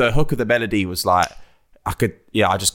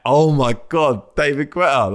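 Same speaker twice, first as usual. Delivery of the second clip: slow, higher, awed oh my God; retold starstruck gasp; 5 semitones higher than usual. Retelling how he reacted to hearing [name]'s name, higher and slow with awe. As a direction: act out being star-struck all over again.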